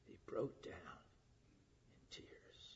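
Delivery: hushed whisper; whispered, moved telling. Acted. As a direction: drop to a whisper on the tears. Describing Jesus weeping, emotional climax.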